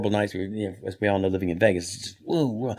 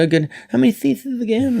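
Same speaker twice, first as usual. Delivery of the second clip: high mock Leno voice; mimicking Jay Leno high; Jay Leno impression; 13 semitones higher, 4 dB louder than usual. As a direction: imitate his eager question. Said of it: "I say".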